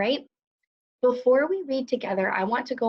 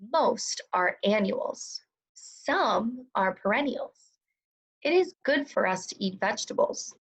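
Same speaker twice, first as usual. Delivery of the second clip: slow, crisp model reading. Shown as a demonstration. Teacher modeling fluency for second graders, slow and crisp. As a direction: read slowly, every sound crisp, pause at each period. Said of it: "I read really nice and slow ... crisp and clear".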